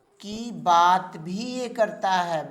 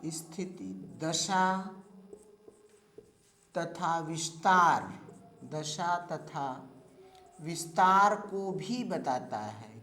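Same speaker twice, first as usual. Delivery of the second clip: slow, softer dictation with long gaps; teacher dictating to a class, slow and soft; 4 semitones lower, 3 dB quieter, slower than usual. Shown as a demonstration. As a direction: read the notes out word by word for copying. Dictates notes to students.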